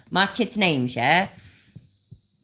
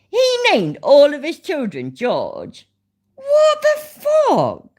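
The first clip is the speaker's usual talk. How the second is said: slow, loud, high-pitched incredulity; utter disbelief; 11 semitones higher, 5 dB louder, slower than usual. Real, not acted. Streamer learning all the boxer's children are George, astonished. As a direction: stretch it out, pitch shooting right up in shock.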